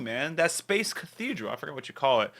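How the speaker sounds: ordinary delivery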